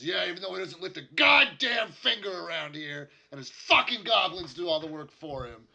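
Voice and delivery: loud, high-pitched angry outburst from a disgruntled worker; angry complaint. Acted. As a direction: vent hard, swearing loudly over the others. Disgruntled worker complains about goblins.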